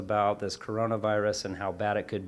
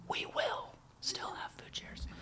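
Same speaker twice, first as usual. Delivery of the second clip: conspiratorial whisper, a bit slower; whispered aside; 12 dB quieter, slower than usual. Acted. Sneaking a promise to the listeners about food shares, whispered. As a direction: lean in and whisper the secret.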